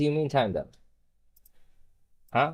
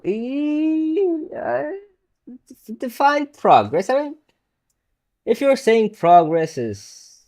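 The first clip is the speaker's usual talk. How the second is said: mock indignant; 12 semitones higher, 5 dB louder than usual.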